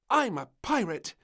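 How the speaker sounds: put-on high, loud little girl announcing; proud announcement. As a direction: declare it proudly. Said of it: "she announced".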